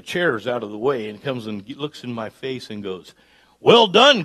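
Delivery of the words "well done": loud and high prophetic proclamation; a big preacher proclaiming well done; booming declaration. Retold or imitated. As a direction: boom it out like a prophet in your face.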